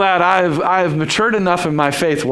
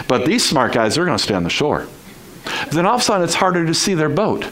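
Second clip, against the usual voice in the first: ordinary talk, nothing marked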